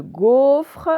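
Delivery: "gaufre" with a long, loud O; stressed first syllable. Shown as a demonstration. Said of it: "On l'entend dans la première syllabe". (« gaufre », le O long et appuyé)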